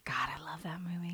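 soft whisper; whispered aside. Real, not acted. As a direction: breathe it as a secret.

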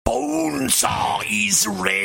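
slow wrestler's shout, hugely drawn out; Randy Savage. Retold or imitated. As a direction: bellow it like a showboating wrestler. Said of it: "Randy Savage".

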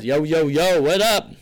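loud, high hype-man hello; loud shout-out to the live chat; hyped greeting. Acted. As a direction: fire up the crowd.

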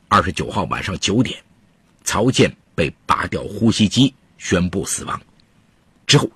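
measured storytelling voice; true crime narrator. A narrator's reading. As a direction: tell the case like a radio crime story.

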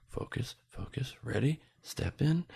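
soft, slow, nearly breathless focus; hushed self-talk before stepping into ice water; self-coaching murmur. Retold or imitated. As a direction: talk yourself into the ice quietly. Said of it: "So I was just like".

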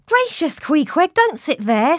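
high, startled cry; alarmed exclamation. A narrator's reading. Narrator alarmed as Queequeg sits on the sleeper, high and startled. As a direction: jump in alarm at him.

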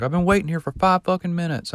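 high, quick mock-indignant rant; retold irritation in a high, hurried voice; imitated angry complaint. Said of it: "he was still mad".

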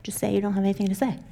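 quiet, comforting inner voice; gentle, soothing. Retold or imitated. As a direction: say it gently like warm tea for a sore throat. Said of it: "just gently, like a cup of tea when your throat hurts".

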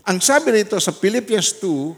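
ordinary talk, nothing marked

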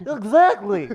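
high squeaky cartoon voice; cartoon character voice. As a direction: pitch it way up like a cartoon. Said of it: "You sounded like a cartoon character".

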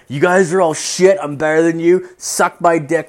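loud, high-pitched taunting; drunken telling-off. Retold or imitated.

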